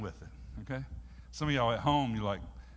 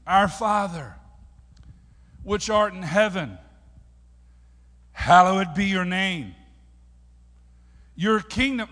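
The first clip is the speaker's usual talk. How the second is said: loud, higher recitation in measured phrases; declaimed prayer; 5 semitones higher, 11 dB louder, slower than usual. Acted. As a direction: proclaim each phrase of the prayer loudly, with pauses.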